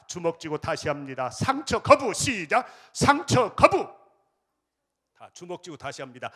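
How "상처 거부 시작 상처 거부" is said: loud, high slogan shout; rally slogan. Acted. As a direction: lead the crowd in a battle cry. Leading a call-and-response slogan.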